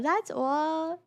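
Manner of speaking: ordinary talk, nothing marked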